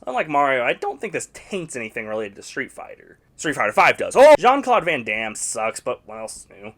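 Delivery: ordinary delivery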